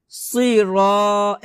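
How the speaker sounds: slow "সির" with thick s and rounded raw; full, dark s opening "সির"; heavy sad sound. Shown as a demonstration. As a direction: draw out "সির" with velarized s. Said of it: "সদ একটু মোটা হবে".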